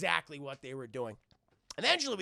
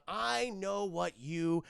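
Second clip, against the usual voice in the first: slow, drawn-out dramatic line; mock ominous tempting; 3 dB louder, much slower than usual. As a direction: savour each word like a tempter. Puck tempts Demona.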